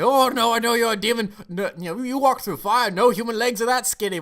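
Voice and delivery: high, loud, hammed-up alarm; panicked accusing. Hunter insisting he faces a demon, mock panicked. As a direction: argue back in a mock-terrified high voice.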